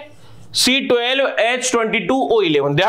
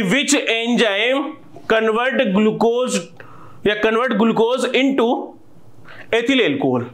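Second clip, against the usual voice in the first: slow dictation; much slower than usual. Shown as a demonstration. Dictating the exam question.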